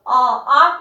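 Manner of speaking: आ stretched long and open; drawn-out aa sound. Shown as a demonstration. Teacher showing pupils the aa sound, drawn out. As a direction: hold the vowel आ out clearly for pupils. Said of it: "आगत ध्वनि निकलनी चाहिए".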